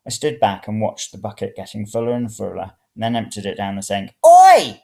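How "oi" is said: high, loud Oi; shout. A narrator's reading. Old woman shouts at the man pouring water away, high and loud. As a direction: bark it across the room. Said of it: "the old woman shouted".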